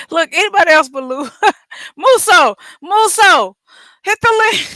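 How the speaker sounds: high, loud call broken by laughs; playful calling out. Real, not acted. Host begging anybody else but Lou to join, playful calling out. Jokingly calls for other callers.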